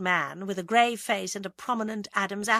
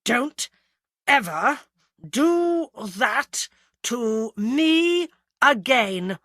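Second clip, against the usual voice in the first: DON'T, EVER, AGAIN each punched loud and apart; very slow, loud warning with a gap after "Don't" and "ever"; punctuated word by word; 5 semitones higher, 8 dB louder, much slower than usual. A narrator's reading. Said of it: "punctuated each shake by saying".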